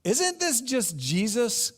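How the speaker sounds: high, slow, dismissive voice; a hint of a sneering neighbour; scoffing townsperson. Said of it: "Or here in chapter six".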